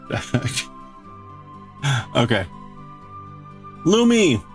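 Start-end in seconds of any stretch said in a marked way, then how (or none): none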